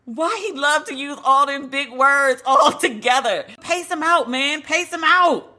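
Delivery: high-pitched, exasperated mock complaint; comic exasperation. Podcaster griping about a rapper's big words, comically exasperated.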